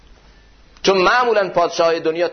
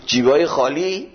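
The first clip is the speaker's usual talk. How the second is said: ordinary delivery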